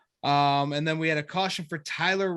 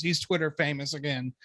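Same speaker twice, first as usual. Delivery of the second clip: ordinary talk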